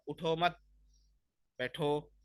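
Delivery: long gap between "मत" and "बैठो"; pause after मत. Shown as a demonstration. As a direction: run "उठो, मत" together, stop, then "बैठो". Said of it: "मत के बाद थोड़ी वक्फ करूंगा".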